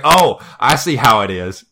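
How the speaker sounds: put-on loud Southern-accented villain retort. Ganondorf reacts to a kid challenging him, loud Southern accent. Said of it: "A southern one".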